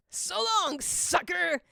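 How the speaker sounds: high taunting yell; villain taunts while flying away, yelling; gleeful yell. A narrator's reading. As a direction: shout it as you fly off. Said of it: "Eggman yells".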